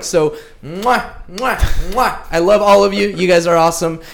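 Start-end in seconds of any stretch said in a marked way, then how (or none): 0.0-2.3 blowing kisses; smacking kisses to the audience; between kisses. Real, not acted.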